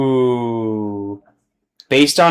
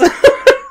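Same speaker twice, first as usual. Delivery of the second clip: high laugh. Real, not acted. Laughing at the meme.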